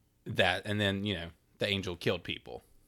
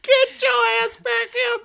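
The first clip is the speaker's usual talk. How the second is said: mother shouting; 12 dB louder than usual. Retold or imitated. Acted mother yelling.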